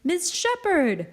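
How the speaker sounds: high, slow, loud cry of recognition. A narrator's reading. Two children spotting their neighbour on the stairs, crying out. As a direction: call the name out high and drawn out. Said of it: "they cry".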